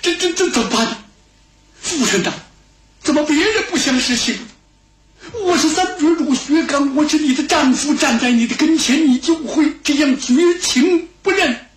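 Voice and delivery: high, anxious pleading to his wife. Acted. Husband begs his estranged wife to recognize him, anxiously. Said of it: "薛刚急了".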